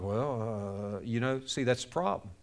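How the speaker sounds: low, very soft, reluctant mumbling; timid, hesitant and hushed; submissive hesitation. Acted. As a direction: hedge meekly, avoiding the question. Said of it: "people are just submissive right away".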